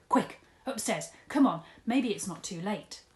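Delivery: loud, hurried urging; urgent. Acted. Dad urging Mister Tugg to hurry upstairs. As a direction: rush him up the stairs.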